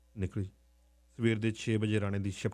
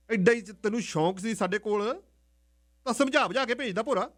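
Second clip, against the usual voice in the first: aunt complaining how the father will blame her; worried aunt; 10 semitones higher, 4 dB louder than usual. Acted. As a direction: fret and grumble about the blame.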